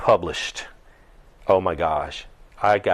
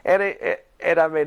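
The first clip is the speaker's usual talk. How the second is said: ordinary talk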